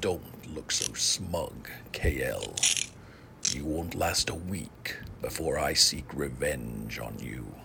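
low, nearly whispered vow of revenge; quiet menace. Acted. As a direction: threaten under your breath, voice falling away. Arrested villain threatens his captor.

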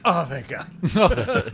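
relieved sigh of relief. Retold or imitated. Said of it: "I like when he's like".

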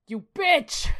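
high, loud burst of rage; furious shout. Acted. Alan lashes out at the crone, enraged shout. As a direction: scream it at an enemy.

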